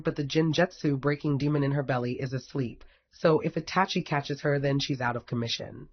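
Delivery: ordinary delivery, nothing marked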